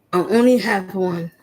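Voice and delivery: ordinary talk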